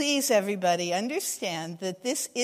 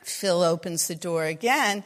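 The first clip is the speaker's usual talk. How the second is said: normal talking voice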